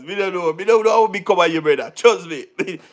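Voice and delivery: ordinary talk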